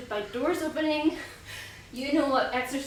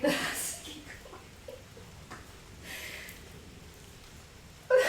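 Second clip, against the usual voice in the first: nervous laugh; 5 semitones higher, 6 dB quieter than usual. Real, not acted. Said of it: "This is like a nervous laugh".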